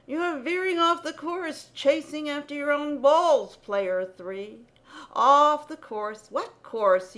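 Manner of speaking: scolding. Acted. Scolding the player.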